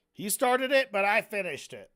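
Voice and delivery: acted, emphatic comeback; defiant boast. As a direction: declare it proudly as if it settles everything. Defiant boast of the hypothetical attacker.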